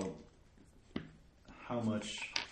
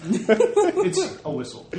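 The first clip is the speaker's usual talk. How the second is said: laughing; 12 dB louder, slower than usual. Real, not acted. Players joking about the horn.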